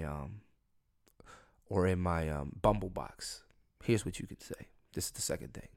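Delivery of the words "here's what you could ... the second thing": confiding whisper. Real, not acted. Confiding tip to audience.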